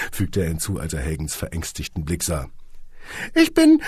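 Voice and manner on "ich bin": very high, loud squeaky boss; squeaky little man. Acted. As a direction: squeak it out grandly. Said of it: "piepste das Männchen".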